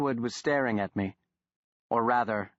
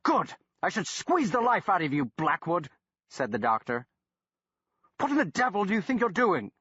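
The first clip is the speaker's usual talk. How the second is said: exasperated doctor, pitched higher; irritated doctor; 7 semitones higher than usual. A narrator's reading. Doctor berating the man who followed him, exasperated. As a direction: scold him like a fed-up scientist.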